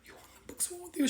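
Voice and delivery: high, fast whispered aside; hushed aside. Retold or imitated. Whispering to the Gemini friend who is scolding the host. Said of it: "I was like".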